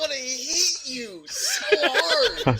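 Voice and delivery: high, slow through laughter; words squeezed out by laughing. Real, not acted. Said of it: "laughing while saying it".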